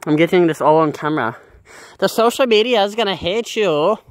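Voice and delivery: taunting. Real, not acted. Threatening the neighbour on camera.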